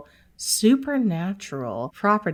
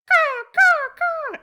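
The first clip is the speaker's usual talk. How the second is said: high, loud cawing; the crow calls again; imitated crow caws; 6 dB louder than usual. Crow calling to the host again, loud caws. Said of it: "And he did it again".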